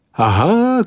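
sharp high exclamation; a triumphant shout of discovery. A narrator's reading. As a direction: call out Aha in triumph. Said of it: "krzyknął ktoś".

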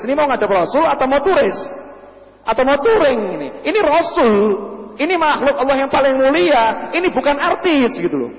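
high-pitched, exasperated scolding; indignant rebuke. Acted. Preacher acts out indignant rebuke of tourists.

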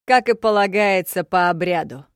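barbarian proclamation. A narrator's reading.

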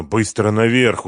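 plain reading voice

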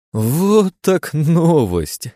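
high, very slow gleeful voice; cackling hell torturer. Acted. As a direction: savour it like a maniac. Hero's mad inner delight.

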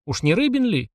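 lightly amazed question, voice lifting; a touch of astonishment at the name. A narrator's reading. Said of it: "изумилась наша героиня".

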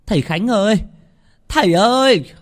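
high, loud call from outside; frantic shout at the gate; urgent calling out. A narrator's reading. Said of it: "tiếng gọi ở ngoài cổng".

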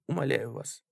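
soft, hushed entreaty; whispered plea. A narrator's reading. Sickly lady implores her companion, whispering. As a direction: implore him quietly. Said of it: "шептала болезненная дама".

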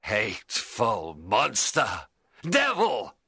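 high, loud, slow hateful shouting; furious rage. Acted. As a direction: spit every word at the creature. Frankenstein rages at monster.

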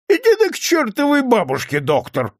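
high-pitched, exasperated dismissal; annoyed drunken flare-up; irritated outburst. A narrator's reading. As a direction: snap at him, pitch leaping up. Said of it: "раздражённо стукнул кулаком по столу".

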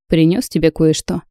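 usual speaking voice

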